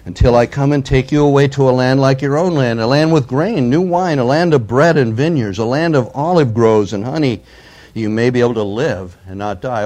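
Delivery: loud, declaiming the envoy's taunt; forceful scripture reading. Acted. Assyrian envoy taunting Jerusalem, read out loud and forceful. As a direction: proclaim it like a herald's challenge.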